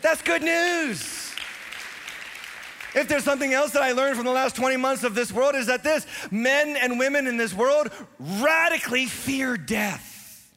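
high, loud, driving sermon voice; preaching at full force. Acted. Pastor urging the congregation to give, preaching at full force. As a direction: preach it hard to the whole room.

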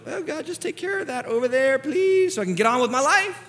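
high, put-on casual plea; flippant mock prayer. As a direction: toss off a prayer, pitched high. Flippant prayer about small sin, acted.

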